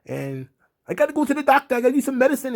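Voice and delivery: a hammed-up Irish show-off pleading; mock Irish accent. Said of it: "all of a sudden he was like".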